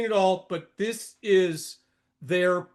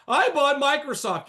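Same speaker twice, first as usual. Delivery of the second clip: higher-pitched mocking quote; mock boast; 6 semitones higher, 3 dB louder than usual. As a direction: imitate a bragging buyer. Mimics bullish buyers boasting.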